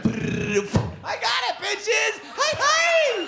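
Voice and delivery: put-on high loud drunk cheer; drunk wife. Wife rising from a fall cheering the room, loud high voice. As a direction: crow it triumphantly.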